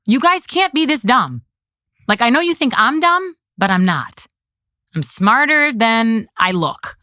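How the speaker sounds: put-on high-pitched talking-down to little kids; scolding like children. Commissioner telling politicians she's not dumb, condescending scolding. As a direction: set them straight like your own kids. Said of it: "like they're five-year-olds, like they're my kids".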